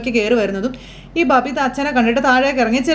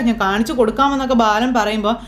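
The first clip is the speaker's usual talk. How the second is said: normal talking voice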